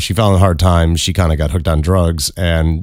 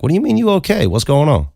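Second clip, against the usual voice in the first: high-pitched, puzzled prodding; prodding questioning; 10 semitones higher than usual. Retold or imitated. Teller re-enacts prodding the woman for more, questioning. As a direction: push for more, pitch jumping up. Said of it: "just questioning, seeing what the fuck's up, 'cause I'm prodding".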